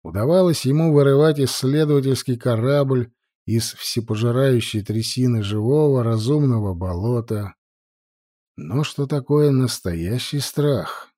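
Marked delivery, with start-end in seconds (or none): none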